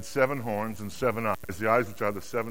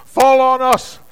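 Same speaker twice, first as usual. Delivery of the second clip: high, sustained shouting; loud, pleading wail of words; desperate crying out; 12 semitones higher, 13 dB louder than usual. Acted. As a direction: cry out to the mountains in dread. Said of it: "they cried out to the mountains".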